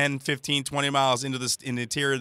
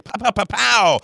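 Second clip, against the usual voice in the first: mock pow pow gunfire, raised; gunshot noises; 8 semitones higher, 4 dB louder than usual. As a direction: play the spy firing shots. Mimicking James Bond gunfire.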